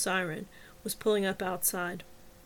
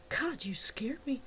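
whispering; 4 semitones higher, 6 dB quieter, slower than usual. Acted. Startled by Samantha at elbow.

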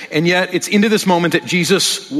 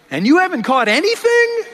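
much higher, playful teasing voice; teasing Jesus; 12 semitones higher than usual. Retold or imitated. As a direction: rib the fishermen like a friendly joker. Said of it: "Jesus standing there going".

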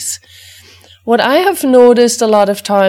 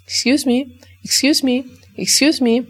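higher, insistent little nudging voice, over and over; pestering dog; 6 semitones higher than usual. Retold or imitated. Dog nudging its owner at walk time, higher pestering voice. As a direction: play the dog tugging at your sleeve. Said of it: "your dog comes to you and goes".

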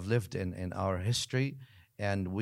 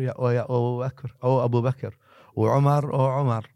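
calling out; 6 dB louder than usual. Retold or imitated. People calling companions by name.

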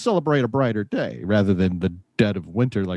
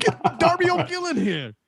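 mock Scottish accent. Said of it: "Scottish accent".

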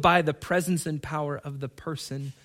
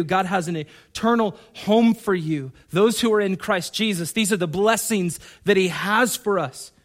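ordinary talk

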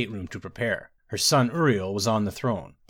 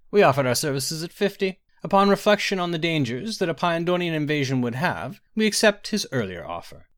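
higher, formal negotiating voice; formal order leader; 6 semitones higher than usual. A narrator's reading. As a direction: set out terms as a mage envoy.

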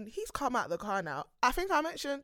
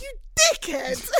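much higher, louder mock yell; insult yell; 14 semitones higher, 7 dB louder than usual. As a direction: hurl the insult. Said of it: "he's like".

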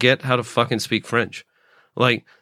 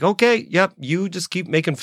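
higher-pitched, goading comeback; defiant retort; 6 semitones higher than usual. Retold or imitated. Comic silently answering the act who mocked him, mock defiant. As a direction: act out egging a mocker on, voice lifted. Acting out his reply to mockery.

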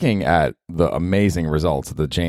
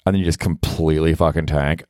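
ordinary talk, nothing marked

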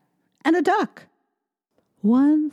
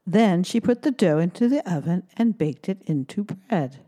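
ordinary delivery

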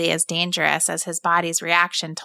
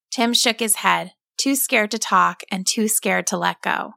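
plain reading voice

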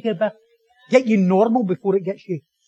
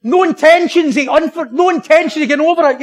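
defiant declaration; 5 semitones higher, 7 dB louder than usual. Pretend. Preacher voicing people nursing grudges, loudly defiant. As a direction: belt out stubborn refusal for comic effect.

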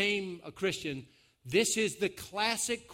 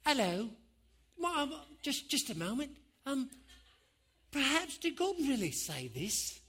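soft, higher-pitched, hesitant tempter; polite serpent voice; 6 semitones higher, 4 dB quieter than usual. Acted. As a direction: tiptoe in politely, high and soft. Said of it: "a snake that comes up and says".

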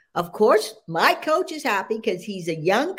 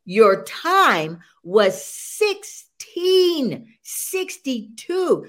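slow announcement; much slower than usual. Retold or imitated. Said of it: "he says".

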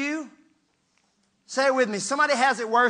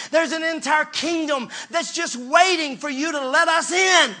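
fiery scolding from the pulpit; scolding preacher; 5 semitones higher than usual. Real, not acted. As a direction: preach it hard at them.

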